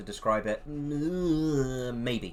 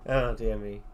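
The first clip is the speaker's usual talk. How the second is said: ordinary delivery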